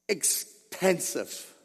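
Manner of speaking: Expansive drawn out slowly and softly; savoring the word. Shown as a demonstration. Preacher savoring the word Expansive. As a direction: linger over Expansive lovingly. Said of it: "I love that word".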